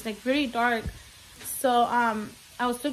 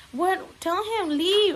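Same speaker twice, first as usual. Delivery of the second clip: higher-pitched pleading kid, lightly mimicked; imitated scared child; 7 semitones higher, 4 dB louder, slower than usual. Son begging mom to send man away, imitated scared child. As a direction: raise pitch to quote a frightened child. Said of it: "my baby started crying".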